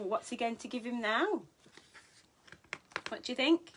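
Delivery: ordinary delivery, nothing marked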